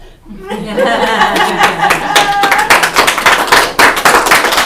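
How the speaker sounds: loud, high burst of real laughter; big laugh. Real, not acted. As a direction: let a full laugh burst out at your own joke. Laughs after own joke.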